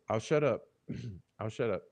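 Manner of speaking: ordinary talk, nothing marked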